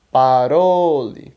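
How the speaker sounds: "parole" very slow and loud on RO; pa-ROOO-le, drawn out as the correction; correct stress, emphatic. Shown as a demonstration. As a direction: stretch the middle syllable of "parole". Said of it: "você vai falar parole".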